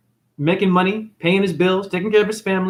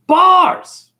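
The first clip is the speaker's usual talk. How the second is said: high, loud punchline shout; shouted catchphrase; 13 semitones higher, 8 dB louder than usual. Acted. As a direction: yell it as a mic drop. Comedic catchphrase exclamation.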